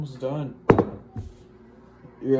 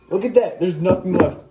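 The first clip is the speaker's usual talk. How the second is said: excited cheering; 6 semitones higher, 10 dB louder than usual. Real, not acted. Cheering the finished challenge.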